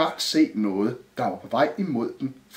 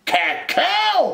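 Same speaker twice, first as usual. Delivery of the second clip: high, falling cupboard yell; shouted echo; 4 dB louder than usual. A narrator's reading. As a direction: holler it back in surprise. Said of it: "råbte skabet". (råber tilbage)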